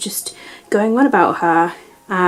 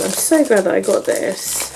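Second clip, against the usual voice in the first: ordinary talk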